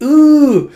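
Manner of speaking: high, loud squeal of delight; delighted ooh. Retold or imitated. Kid reacting to tea being offered, high excited ooh. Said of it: "It's like".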